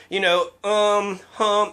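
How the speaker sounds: lightly voiced slow thoughtful hesitation; mock slow pondering. Mocking Cover's slow Obama-style pauses, lightly. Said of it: "he has this Obama kind of sort of".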